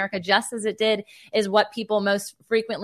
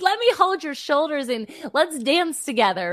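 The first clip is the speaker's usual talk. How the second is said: higher and louder, eager and joyful; excited invitation; 7 semitones higher, 4 dB louder than usual. Retold or imitated. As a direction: grab them playfully and invite them to dance. Quoting herself to doubters.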